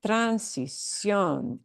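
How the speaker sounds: "Transición" slowly, ending punched; tran-si-CIÓN, drawn out onto the last syllable; stress on CIÓN. Shown as a demonstration. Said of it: "where you do indeed punch the word".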